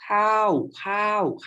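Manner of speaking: "Cow" pitched very high and loud as Thai for rice. Shown as a demonstration. Teacher showing Thai khao meaning rice, very high pitched. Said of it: "pronounce the word K A O in five different tones".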